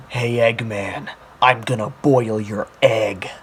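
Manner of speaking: slow, low mock-menacing threat; hammed-up deep hero voice; parody game antihero. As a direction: deliver a silly threat slowly and low.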